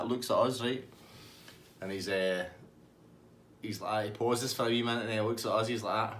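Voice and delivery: ordinary talk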